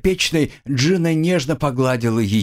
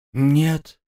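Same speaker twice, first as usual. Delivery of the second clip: breathy exhale; 6 semitones higher than usual. A narrator's reading. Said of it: "выдохнула девушка".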